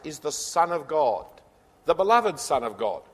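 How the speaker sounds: ordinary delivery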